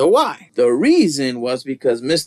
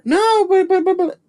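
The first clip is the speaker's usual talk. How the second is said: very high sputtered objection; mock flustered protest. Coven lady objecting as Roman steps in, mock flustered. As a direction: stammer a protest in a squeaky put-on voice.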